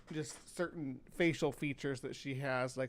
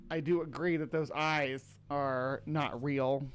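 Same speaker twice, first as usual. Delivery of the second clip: ordinary talk, nothing marked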